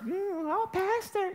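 hammed-up breathy flirt; mock seductive woman. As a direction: coo it like a flirting admirer. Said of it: "When some woman starts".

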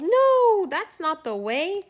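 loud, high yell easing after "No"; children yelling. A narrator's reading. Children stopping the snowman undressing, yelling. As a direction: yell a big high "No", then ease slightly. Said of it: "The children yelled".

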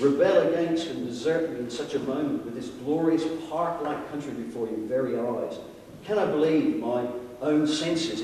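usual speaking voice

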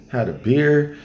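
ordinary talk, nothing marked

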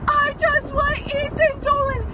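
high, loud, slow yell out the window. Retold or imitated. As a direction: shout it out the car window. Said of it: "is yelling out".

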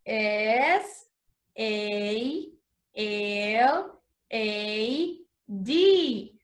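letters spaced out slowly; slow, clear spelling for learners. Shown as a demonstration. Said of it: "spelling the word slowly".

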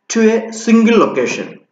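normal talking voice

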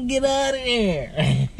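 taunting singsong. Pretend. Taunting her on screen.

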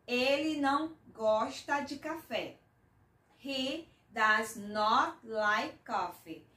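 very slow, clear model for learners; careful teacher demonstration; very slow model sentence. Shown as a demonstration. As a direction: lay each word out for the class. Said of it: "slow model sentence for learners".

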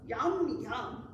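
usual speaking voice

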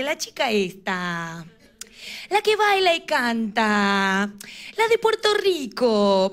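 put-on slow, halting old-lady recall; slow grandma voice. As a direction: drag it out like a confused grandmother. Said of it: "me dice". (abuela lenta y despistada)

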